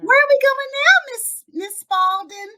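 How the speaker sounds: put-on high, sing-out child question; innocent little boy. Boy after his meltdown asking the mom, innocent. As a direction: ask in a piping kid voice. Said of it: "he's gonna be like".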